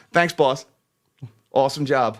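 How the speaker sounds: ordinary delivery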